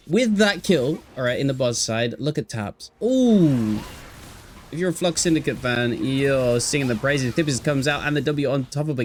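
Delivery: lively caster commentary; play-by-play. Acted. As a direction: call the action as it happens. Live play-by-play of a fight.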